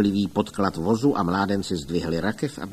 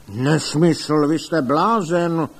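loud, raised indignant retort; angry bluster, higher and louder; blustering outburst; 5 semitones higher, 5 dB louder, slower than usual. A narrator's reading. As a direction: boom it out dismissively. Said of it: "hučel pan doktor Link".

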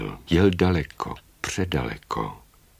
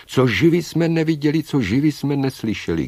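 high, louder bird chorus; chorus in unison; 8 semitones higher, 5 dB louder than usual. A narrator's reading. Birds answer the old woman all together, high chant. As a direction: answer as one flock, pitched high. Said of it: "všichni sborem odpovídají".

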